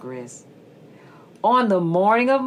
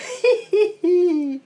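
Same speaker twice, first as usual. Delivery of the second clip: short high chuckle; a quick amused laugh; skunk laugh; 10 semitones higher than usual. Acted. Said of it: "The skunk laughed".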